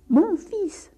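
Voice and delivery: exasperated father, high and loud; emphatic loud repeat. Acted. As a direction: spell it out loudly for deaf ears. Father repeats for deaf priest.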